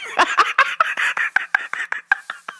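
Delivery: breathless whispered wheeze; laughing. Real, not acted. Cracking up about the kick, silent wheeze.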